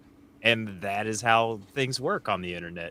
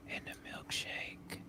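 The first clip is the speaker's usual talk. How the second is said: whispered; 4 semitones lower, 19 dB quieter than usual. Pretend. Guest doing mock ASMR about his milkshake, whispered. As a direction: whisper it close, with long pauses. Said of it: "I'm gonna whisper it again".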